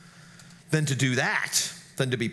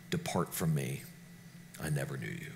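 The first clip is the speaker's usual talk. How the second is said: much lower and softer, fading to almost nothing; haunting words; 13 semitones lower, 11 dB quieter, faster than usual. Retold or imitated. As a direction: let the judgment sink low and grave. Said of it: "haunting words".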